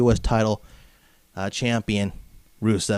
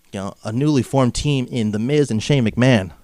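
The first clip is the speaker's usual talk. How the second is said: normal talking voice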